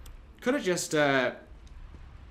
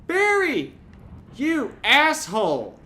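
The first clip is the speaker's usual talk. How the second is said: furious shout; 13 semitones higher, 11 dB louder, slower than usual. Real, not acted. Player yelling at Barry for leaving, furious. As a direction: yell his name high and loud. Barry abandons him in game.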